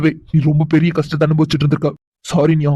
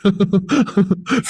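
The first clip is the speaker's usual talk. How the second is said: teasing laugh; 5 semitones higher than usual. Acted. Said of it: "என்ன நீங்க சிரிச்சுட்டே இருக்கீங்க?".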